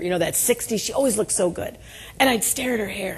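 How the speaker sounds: usual speaking voice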